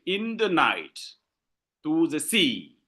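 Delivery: NIGHT and SEA lengthened, first two words light; stress on the last word. Shown as a demonstration. Teacher showing class uneven accentuation, stress on "night" and "sea". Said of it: "when I pronounce, you find that".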